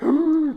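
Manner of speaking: high sharp gasp; mock gasp. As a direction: gasp at the dropped brush. Spotting the brush again.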